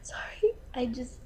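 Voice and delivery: choked, whispery apology; fighting back tears. Acted. Apologizing on her dating video for crying over cats, tearful. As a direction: barely get it out, about to cry. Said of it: "promised myself I wouldn't cry".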